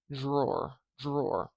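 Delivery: Drawer with a j-like dr, jrawer; palatalized drawer. Shown as a demonstration. Teacher repeating the palatalized version. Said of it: "Do you hear that?".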